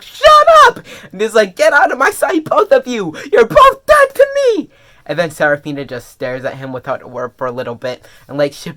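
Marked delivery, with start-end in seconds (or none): 0.0-0.8 very high, loud screech; acted furious shout. Acts John's hysterical outburst. 1.6-4.7 high, loud yell climbing to a screech; acted furious rejection. Acts John disowning both of them.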